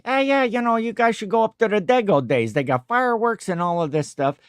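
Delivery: high-pitched imitation of Italian-American cousin; Italian accent. Cousin urges visitors to the festival, Italian accent. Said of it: "a real, like, you know, Italian accent".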